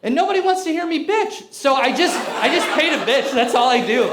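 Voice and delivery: ordinary talk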